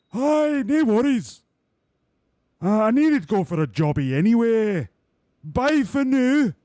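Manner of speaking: high, loud, slow cartoonish driver; cheerful driver voice. Streamer voicing the driver to the team boss, high and loud. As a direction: chirp it out like a goofy racing driver.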